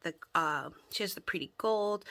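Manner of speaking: normal talking voice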